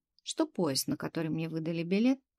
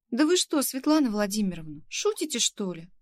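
high, loud burst of disbelief; startled, incredulous protest; choking with shock; 3 semitones higher, 7 dB louder than usual. A narrator's reading. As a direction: blurt it out, aghast, pitch jumping up. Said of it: "поперхнулась я".

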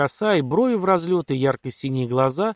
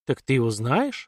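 usual speaking voice

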